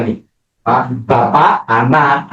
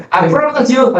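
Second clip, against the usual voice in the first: raised pastor's pronouncement; mock pastor; 10 semitones higher than usual. Pastor declaring the couple married, high ceremonial voice. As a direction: declare it like a wedding officiant. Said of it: "ya, saya berkata".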